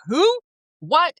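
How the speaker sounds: high, squeaky mock-shocked who and what; replaying the moment the name sank in; stunned disbelief. Hearing her grandma's tape was [name], high shocked yelp. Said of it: "I'm like".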